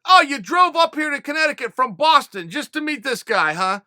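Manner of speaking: retold yell at a fake teacher, loud and mocking. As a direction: call him out loudly like a busted liar. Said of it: "And Dave's like".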